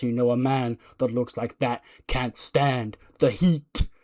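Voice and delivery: slow, portentous preacher's promo; grand wrestling prophet, stretching the words; Messiah. Acted. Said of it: "get a quick promo from the Messiah".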